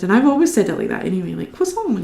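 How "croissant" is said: croissant squeaked high, French-style, kwa-son; croissant pitched way up in a silly French way; weird croissant. Shown as a demonstration. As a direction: say croissant really weird on purpose. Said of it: "you gotta say it really weird".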